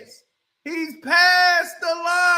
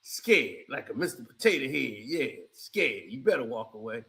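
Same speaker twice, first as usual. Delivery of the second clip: much lower, softer, mocking tone; a low teasing drone; deep mock voice; 7 dB quieter than usual. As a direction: sneer it from way down low. Deep mocking voice about Brunson.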